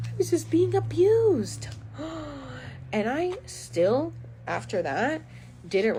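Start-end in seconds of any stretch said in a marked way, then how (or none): 0.1-1.7 high, slow, strained on the edge of tears; fighting back a sob; cracking with emotion; voice breaking. Real, not acted. 1.7-2.9 quiet shaky intake of breath; gasping for air; sharp gasp. Real, not acted. Gasp after emotional line.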